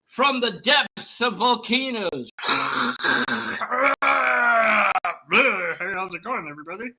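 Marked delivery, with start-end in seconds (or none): 2.3-5.2 monster sound. Pretend. Giving the volcano creature's sound, long roar. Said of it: "That's how he sounds". 5.3-6.9 a playful monster saying hello; volcano creature voice. Pretend. Volcano creature greeting everybody, lightly voiced monster. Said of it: "That's how he sounds".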